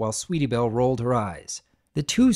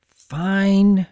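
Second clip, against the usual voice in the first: sulky fine; 6 semitones higher, 6 dB louder than usual. Acted. Sulky giving in.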